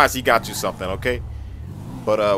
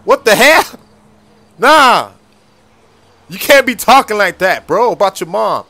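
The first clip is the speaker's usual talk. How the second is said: much higher and louder, indignant; outraged, scolding hard; shocked outrage; 8 semitones higher, 9 dB louder than usual. Acted. Dad scolding son for insulting mom, outraged.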